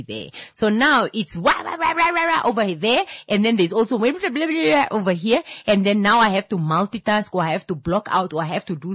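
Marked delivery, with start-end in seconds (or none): 1.4-2.4 high-pitched "wah wah" babble; mock chatter noise. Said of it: "just extra noise on top". 4.0-4.5 high, babbling "blah blah"; mock chatter noise. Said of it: "just extra noise on top".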